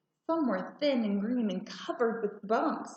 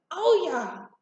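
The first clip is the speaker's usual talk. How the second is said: louder, higher strained "oh, yeah"; effortful grunt; 8 semitones higher, 5 dB louder than usual. A narrator's reading. Mother chopping tough vegetables, straining with effort. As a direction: push the words out with effort.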